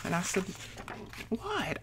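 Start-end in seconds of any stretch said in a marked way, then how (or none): 1.3-1.8 soft, high retold "what"; high, falling note of retold alarm; alarmed question. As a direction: imitate a worried, hushed reaction. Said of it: "I thought something was wrong terribly".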